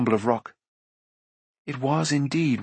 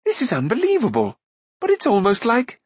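high, wondering exclamation; astonished; 10 semitones higher than usual. A narrator's reading. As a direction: marvel aloud, breaking off unfinished. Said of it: "He turned in surprise".

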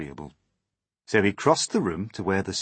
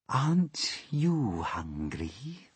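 slow, higher, drawn-out spider voice; hungry spider; 6 semitones higher, much slower than usual. Acted. Spider asking frightened James, slow sinister coaxing. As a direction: draw the question out slowly, pitched up.

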